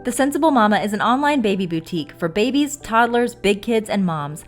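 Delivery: upbeat sponsor read, loud. Acted. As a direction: read it like a commercial.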